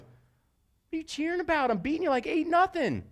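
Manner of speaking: high, slowed, incredulous voice; mocking rival coach. Rival coach asking what she is cheering about, incredulous. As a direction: scoff at the cheering. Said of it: "looks at me and goes".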